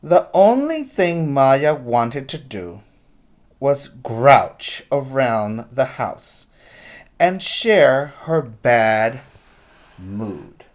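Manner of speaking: put-on lively read-aloud to kids; picture-book reading voice; story narration. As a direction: read it like storytime, big and expressive.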